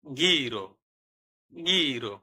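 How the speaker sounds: গিরো with a hard G before i; Italian word demo. Shown as a demonstration. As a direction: model গিরো clearly. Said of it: "উদাহরণ".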